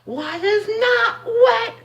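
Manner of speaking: drawn-out, very high mock voice; mocking imitation. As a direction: mimic them in an exaggerated falsetto, dragging each word. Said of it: "if I hear one more person say".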